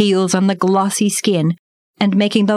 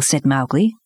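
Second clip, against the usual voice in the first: plain reading voice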